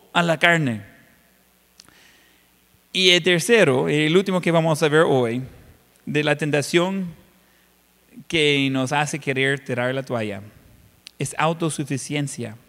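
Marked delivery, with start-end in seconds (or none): none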